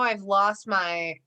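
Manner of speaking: usual speaking voice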